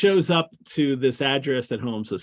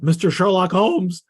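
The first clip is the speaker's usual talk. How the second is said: lightly acted Watson, higher and louder; blurted name; 7 semitones higher, 5 dB louder than usual. Watson finally getting his words out to Sherman, blurted name. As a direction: call the name out to get past a refusal. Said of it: "Watson gets out".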